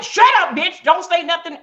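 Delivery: rude husband snapping. Acted. Husband snapping at wife who asked where he's been, mock angry. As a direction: bark it like a disrespectful husband. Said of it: "You know, like".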